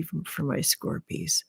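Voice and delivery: trailing into a soft whisper. Real, not acted. Streamer adding a tip for Scorpio viewers, soft whisper. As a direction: let it fall to a whisper.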